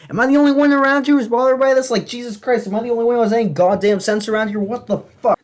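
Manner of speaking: very high, loud, fast exasperated outburst; outraged rant. Acted. Comedian asking why nobody else is bothered by Santa, outraged rant. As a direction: blow up in hammed-up disbelief.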